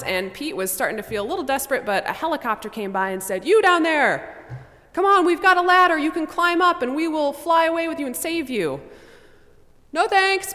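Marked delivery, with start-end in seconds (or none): 3.4-8.8 helicopter crew shouts down, much higher and louder; calling down. Acted. Helicopter crew calls down to Pete, loud and high. 9.9-10.5 high, loud yell back; yelled refusal. Acted. Pete yells up to the helicopter, high and loud. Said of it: "Pete yelled".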